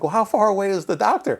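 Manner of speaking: hammed-up impatient demand, half laughing; mock impatience. Father asking staff where the doctor is, mock impatient and laughing. Dad retelling waiting for doctor.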